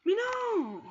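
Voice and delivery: high-pitched dismayed no; shocked cry. Real, not acted. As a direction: cry out in disbelief at the loss. Sudden defeat in game. (cri de stupeur aigu)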